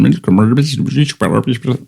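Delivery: garbled like a broken broadcast; mock glitching. Host's broadcast cutting out, garbled mock glitch. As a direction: let the line break up like a bad signal. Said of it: "we're having some technical difficulties".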